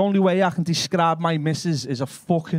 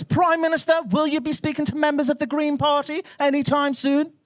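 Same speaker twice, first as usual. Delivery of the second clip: higher, mock-formal press question; posh reporter; 9 semitones higher than usual. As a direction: play a prim journalist at the doorstep. Posh journalist voice.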